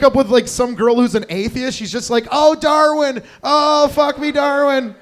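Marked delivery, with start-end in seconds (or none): 2.3-4.9 high, loud parody of passion; hammed-up high wail of ecstasy; mock ecstatic cries. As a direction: belt out drawn-out cries like a lover. Mimicking a lover's cries in sex.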